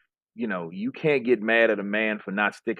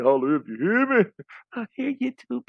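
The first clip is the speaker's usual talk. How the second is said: mock fan gushing; 10 semitones higher than usual. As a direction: gush like a starstruck fan. Mocking fan praising [name].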